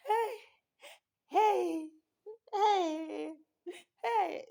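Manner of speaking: retold crying call to mom. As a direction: sob out each hey to mom. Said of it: "I am crying myself silly".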